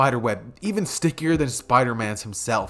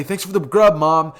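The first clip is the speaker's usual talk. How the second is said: excited rushed thanks; 3 semitones higher, 6 dB louder than usual. Acted. Teen son calling to mom while running out, excited. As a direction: shout it on the way out. Deku rushing out the door.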